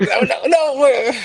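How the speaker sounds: very high-pitched frantic refusal; mock panicked protest. Retold panicked protest in the cab.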